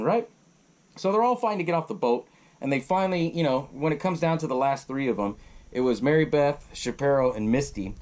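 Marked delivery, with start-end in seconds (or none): none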